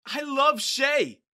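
high, loud yell over screeching. A narrator's reading. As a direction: yell to be heard over a din. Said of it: "I yelled, trying as much as I could".

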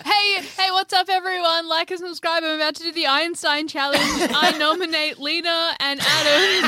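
high-pitched parody influencer intro; mock vlogger. Player parodies nominating friends for the Einstein challenge, mock vlogger. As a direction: hype it like a challenge video.

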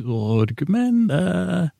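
a lightly voiced Stannis asking, stretched slow; Stannis impression. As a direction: drawl it slowly, up high, as a question. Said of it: "that's what Stannis says".

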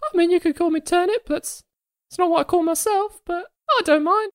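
high, chirpy little creature voice; squeaky fey creature. Acted. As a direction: play a sweet tiny fey critter. Turnip creature speaking.

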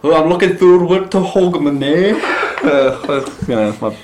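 high, loud put-on Danish accent. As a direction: laugh through a hammy Danish accent. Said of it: "that was a Danish accent".

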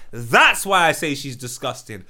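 ordinary talk

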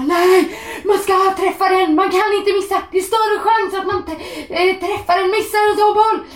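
high, loud exasperated wail; imitated outraged shout. Re-enacting Mikael after the striker's miss, shouting in outrage. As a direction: mimic a fan yelling at a miss. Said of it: "Mikael satt och skrek ut".

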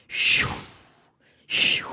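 two breathy swishes, spaced apart; whooshing sound effect. Retold or imitated. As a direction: imitate skis swishing past. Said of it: "jag älskar alla dina ljudeffekter idag". (väsande svischljud)

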